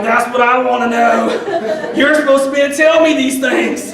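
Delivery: loud, high, laughing protest from the ground; dazed indignation. Retold or imitated. Blind woman on the ground scolding her guide dog: loud, laughing protest. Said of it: "And I'm like".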